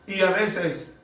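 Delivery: ordinary delivery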